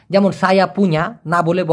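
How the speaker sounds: ordinary talk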